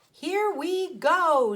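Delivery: slow, high, loud announcement; drawn-out excited call. A narrator's reading. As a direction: stretch it out with glee. Miss Frizzle launches the bus.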